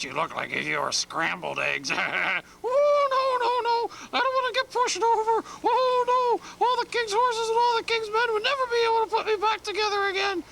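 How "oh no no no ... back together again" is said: very high, frightened little egg voice; panicky egg voice. Acted. Egg begs the bear not to push him over, very high and panicky. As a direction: squeal and beg not to be tipped over.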